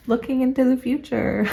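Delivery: giggling through the words; light chuckling; laughing. Real, not acted. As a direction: laugh at your own joke as you speak. Jokes about crystal balls.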